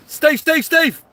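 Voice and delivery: loud, high urgent calls across the beach; shouting for Steve. Real, not acted. Angler yelling for his mate Steve over a bite, loud urgent shout. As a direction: yell his name to get him over fast. Calling mate to a biting rod.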